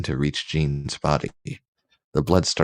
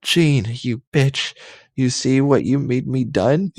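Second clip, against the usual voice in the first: mild raging yell; 8 semitones higher, 3 dB louder than usual. Acted. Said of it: "screamed, screamed Scott in pain and rageness".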